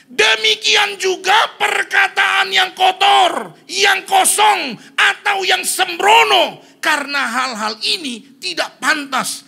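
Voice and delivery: very high, loud, drawn-out shout; preacher declaiming scripture at full force; scripture proclamation. Real, not acted. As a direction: thunder out the verse, slowly.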